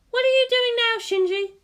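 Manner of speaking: high, lively woman's voice; bright teasing guardian; Misato voice. Acted. Misato questions Shinji at the target, high lively voice. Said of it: "she asked".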